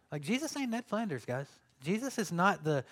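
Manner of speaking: normal talking voice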